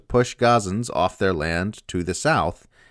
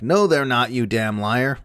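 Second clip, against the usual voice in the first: higher, louder indignant denial; mock angry retort; 5 semitones higher, 4 dB louder than usual. Narrator mimics outraged commenters, mock angry denial. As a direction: snap it back like an outraged commenter. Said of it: "Israel apologists in my comments are like".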